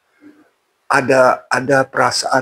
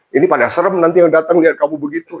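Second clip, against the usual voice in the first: hurried urging; 7 semitones higher, faster than usual. Retold or imitated. Habib urging him to wash.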